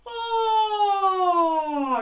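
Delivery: loud open-throated oh; big open oh like at the doctor's. Shown as a demonstration. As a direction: open your throat and call out oh. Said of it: "Gonna open our throat".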